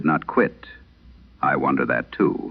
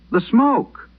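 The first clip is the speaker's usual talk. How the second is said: put-on much higher, scared little boy's voice; frightened crying boy; 3 dB louder than usual. Trapped boy begging the night operator for help, high frightened child voice. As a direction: plead like a terrified small child, pitched well up. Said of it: "The boy was already crying".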